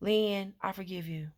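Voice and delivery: softly telling herself; quiet self-forgiveness. Retold or imitated. Speaking softly to herself, trying to forgive herself. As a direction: murmur it to yourself, almost to nothing. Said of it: "I say to myself".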